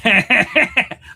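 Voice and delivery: sudden laugh at own joke; loud laugh. Real, not acted. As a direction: burst of high loud laughter.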